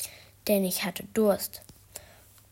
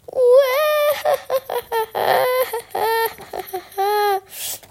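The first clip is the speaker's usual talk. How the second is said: high, loud wailing howl; creepy childish wail; 8 dB louder than usual. Acted. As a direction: let out a spooky yet babyish scream. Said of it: "mit einer gruseligen, dunklen, aber doch kindischen Stimme".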